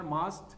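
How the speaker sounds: must said as "mast", short open a; pronouncing must. Shown as a demonstration. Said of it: "como está allí lo vas a pronunciar".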